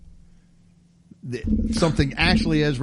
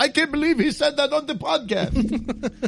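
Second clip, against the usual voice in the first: much higher Jackie Mason comic whine; joking Jackie Mason complaint, pitched way up; Jackie Mason impression; 12 semitones higher than usual. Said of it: "Jackie Mason".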